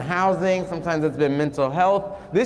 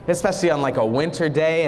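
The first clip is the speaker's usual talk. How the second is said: plain reading voice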